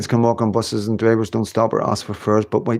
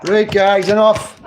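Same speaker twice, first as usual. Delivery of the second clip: calling off the dogs; 10 semitones higher, 5 dB louder, slower than usual. Real, not acted. Telling off the dogs.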